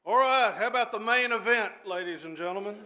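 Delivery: big, high, booming call to the crowd; loud emcee hyping the main event; rousing announcement. Real, not acted. Judge rousing the courtroom for the main event, loud and high.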